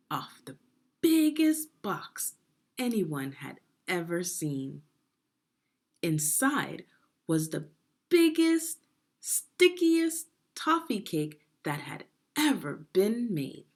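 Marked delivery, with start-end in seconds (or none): 1.0-2.3 "biggest box" high, loud and slowed; stress on biggest. A narrator's reading. Reading to children, "biggest box" stretched big. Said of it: "emphasis in read-aloud". 7.3-12.9 "biggest" and "stickiest" lifted high and loud; building wonder. A narrator's reading. Reading to children, "stickiest" lifted in wonder. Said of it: "emphasis in read-aloud".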